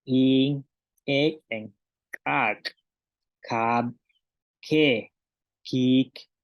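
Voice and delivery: "Kab", "ke", "kik" con la K bien marcada, separadas; pronunciación maya. Shown as a demonstration. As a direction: como ejercicio de repetición. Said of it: "Una vez más". (maestra repite las palabras con K)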